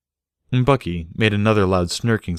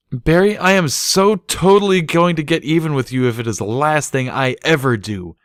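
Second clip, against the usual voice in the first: higher, louder mock vow; vowing revenge; 8 semitones higher, 6 dB louder than usual. A narrator's reading. Bucky mock-vowing revenge on Barry. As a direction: swear it through laughter.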